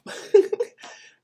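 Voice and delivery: sudden shrill laugh burst; high squealing laugh. Real, not acted.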